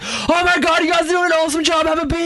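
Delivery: high, fast excited praise; high gushing crowd. Retold or imitated. Festival crowd praising the toilet cleaners, high and excited. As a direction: gush like a grateful festival-goer. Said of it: "everyone just yells and screams like".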